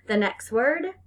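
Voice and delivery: ordinary delivery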